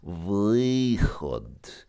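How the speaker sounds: выход stretched right out, vowel gliding; slow-motion teaching demo of выход; very slow pronunciation. Shown as a demonstration. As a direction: draw out выход so both sounds in ы are heard. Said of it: "say выход very slowly".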